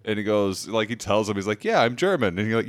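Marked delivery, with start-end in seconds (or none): 1.6-2.4 put-on thick German accent, pitched up and louder. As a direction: imitate a heavy German accent. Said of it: "He's got this crazy thick German accent".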